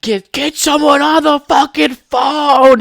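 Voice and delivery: high, slow furious bark; drill sergeant yelling. Retold or imitated. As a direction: scream an order at a recruit. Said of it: "seven drill sergeants just fucking shaking his".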